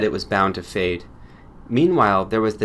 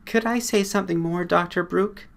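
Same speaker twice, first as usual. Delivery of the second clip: higher, hesitant woman's voice; timid female patient; 9 semitones higher than usual. Acted. Patient asks the doctor if she may speak, higher and hesitant. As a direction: ask shyly for permission to speak.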